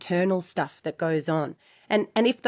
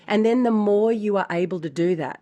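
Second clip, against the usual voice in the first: usual speaking voice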